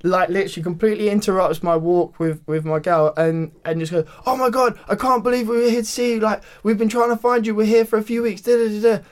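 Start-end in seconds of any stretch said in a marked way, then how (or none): 4.2-9.0 high, loud, excited rush; gushing fan. Retold or imitated. Texan woman to the man from the show, gushing. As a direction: imitate a fan babbling without pause.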